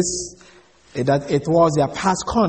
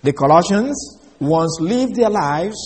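ordinary talk, nothing marked

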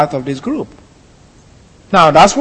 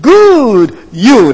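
"Good" punched high and held, then "you"; emphatic; 4 semitones higher, 3 dB louder than usual. Shown as a demonstration. Preacher repeating the Hebrew translation to show it, emphatic stress on "Good". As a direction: land hard on "Good", slightly apart from "you". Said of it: "That's emphatic".

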